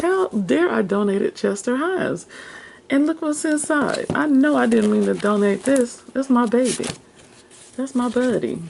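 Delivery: high-pitched retold outrage at herself; mock mad. Retelling her regret over a donated book, mock mad. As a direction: scold yourself in playful, high indignation. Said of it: "I was really mad about this one".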